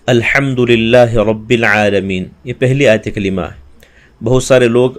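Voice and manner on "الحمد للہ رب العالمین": a slow, careful model recitation. Shown as a demonstration. Teacher models the verse for students, slow recitation. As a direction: recite الحمد للہ رب العالمین slowly, with care. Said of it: "جیسے مثال کے طور پر".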